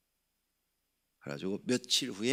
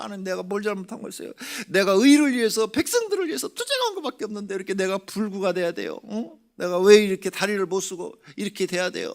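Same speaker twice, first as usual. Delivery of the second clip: much higher, louder wailing protest to God; anguished resentful prayer; 10 semitones higher, 8 dB louder than usual. Retold or imitated. Man in wheelchair crying out against God, high anguished wail. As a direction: cry out to God, bitter and hurt. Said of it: "원망의 기도를 했대요".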